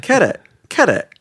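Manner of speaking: Carrot said high with a falling tune, for [name]; mispronounced name. Retold or imitated. Imitating how the mama said his name, high. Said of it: "That's how she was saying my name".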